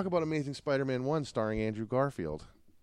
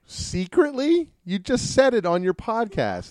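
much higher, loud, stretched disbelief; mock-shocked call-out, slow and loud; incredulous; 10 semitones higher, 10 dB louder than usual. Real, not acted. Calls out friend's secret.

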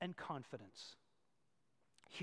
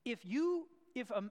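ordinary talk, nothing marked

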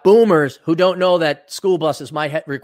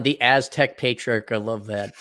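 usual speaking voice

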